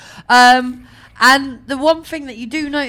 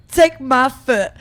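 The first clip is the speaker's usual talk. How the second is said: slow, loud, higher-pitched order; retold urgent command; 4 semitones higher, 8 dB louder, slower than usual. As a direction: act out a demand to be hoisted up. Retelling command to man in crowd.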